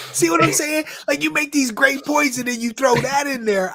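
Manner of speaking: high and loud, still laughing. Real, not acted. Host reacts laughing to the rude comment, high and loud.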